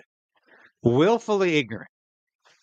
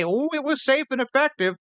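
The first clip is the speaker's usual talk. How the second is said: high mocking singsong; parroting in mock singsong; 9 semitones higher than usual. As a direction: sneer the official line, higher. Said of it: "We all have to say".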